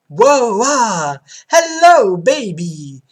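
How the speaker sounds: loud flirty whoa; excited cry. Acted. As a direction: call out to her, thrilled. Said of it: "cries the K G B agent".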